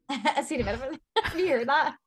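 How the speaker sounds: laughing through the word; laughing in disbelief at the name. Real, not acted. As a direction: giggle the question out.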